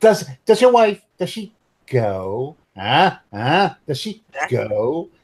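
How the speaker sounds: slow, suggestive leer with a rising question; Monty Python sketch voice, slow and suggestive. Acted. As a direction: lean in like the nudge-nudge man. GM plays Python "nudge nudge" man.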